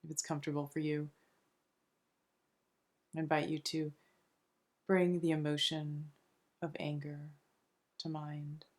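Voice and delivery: soft, soothing meditation voice with long pauses between phrases; unhurried, hushed relaxation guide; guided meditation. Acted. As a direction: gently guide them into calm, phrase by phrase.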